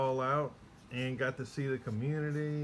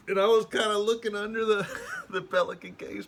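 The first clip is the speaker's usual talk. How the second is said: high, louder, laughing through it; giggling while peeking at the cards; sneaky peek, laughing; 9 semitones higher, 9 dB louder than usual. Real, not acted. Peeking at cards under case.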